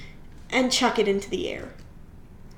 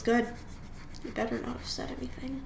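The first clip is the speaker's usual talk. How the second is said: soft quick menacing warning; quiet threat; 9 dB quieter, faster than usual. Retold or imitated. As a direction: warn him quietly, fast.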